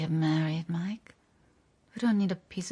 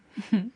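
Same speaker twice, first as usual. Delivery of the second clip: fond little laugh; 2 semitones higher, 3 dB louder than usual. Acted. Greta teasing Mike.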